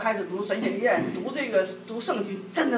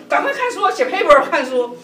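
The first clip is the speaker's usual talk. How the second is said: higher and louder, pressing him; hurry-up nagging; 5 semitones higher, 7 dB louder than usual. Retold or imitated. Wife urging husband to study at night, loud nagging. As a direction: chase him back to the books.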